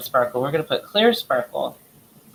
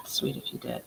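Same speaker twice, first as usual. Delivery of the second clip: breathy whisper after held breath; 10 dB quieter than usual. Real, not acted. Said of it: "Did anybody else hold their breath".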